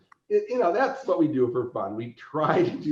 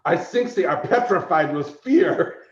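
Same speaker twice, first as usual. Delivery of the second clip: loud, higher-pitched bad German accent; deadpan declaration in a hammy German accent; mock-serious German accent; 6 semitones higher, 5 dB louder than usual. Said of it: "looks at me with all seriousness".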